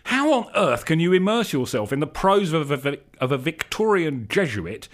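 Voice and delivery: high, loud alarmed screech. A narrator's reading. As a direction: shriek it out in panic. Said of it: "screeched Istvan".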